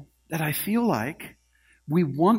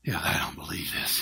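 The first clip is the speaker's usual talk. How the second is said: hushed, lower whisper in disbelief; awed whisper; 10 semitones lower, 6 dB quieter than usual. Retold or imitated. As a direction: whisper it in awe. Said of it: "He goes".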